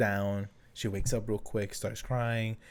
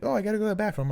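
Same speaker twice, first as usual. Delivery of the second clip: lightly voiced wife, high and breezy; a hint of a casual wife; a light imitation of wife; 11 semitones higher, much faster than usual. Dad imitating his wife heading to the bathroom, high. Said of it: "And Grady's like".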